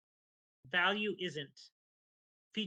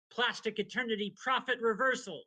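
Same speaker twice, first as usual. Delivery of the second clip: shouted attack name; 6 semitones higher, 6 dB louder than usual. Acted. Announcing the team's ultimate attack.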